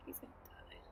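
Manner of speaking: ominous whisper. Real, not acted. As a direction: hush it as a grim aside. Aside about character.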